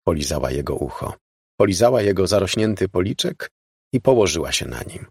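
ordinary delivery

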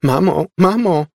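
put-on high, loud child's squeal. A narrator's reading. Laura calls her mother, squealing. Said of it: "pisnęła Laura".

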